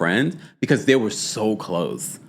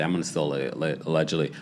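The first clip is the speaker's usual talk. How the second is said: hushed aside; 7 semitones lower, 8 dB quieter, much faster than usual. Real, not acted.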